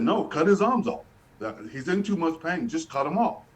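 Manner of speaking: a mother at the end of her rope, pushing hard; desperate plea. Retold or imitated. Mother insisting to the doctor, in a high, urgent plea.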